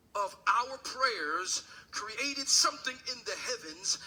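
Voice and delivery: ordinary delivery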